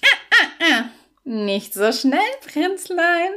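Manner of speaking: high, laughing toad voice mocking the prince; teasing toad laugh. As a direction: laugh him off from up high, playful and sly. Toad teases prince before payment.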